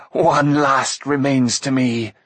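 slow, drawn-out menace; understated harsh triumph, each word stretched; harsh defiance. A narrator's reading. Fallen queen revealing her last power, slow harsh defiance. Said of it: "Acren laughed harshly".

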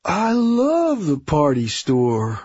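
dopey delight. Acted. Coleman hears of a store trip, slowly delighted. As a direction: stretch out "love" with relish.

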